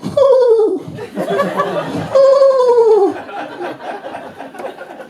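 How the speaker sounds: long wheezing high laugh. Real, not acted. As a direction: break down laughing. Cracks up at story.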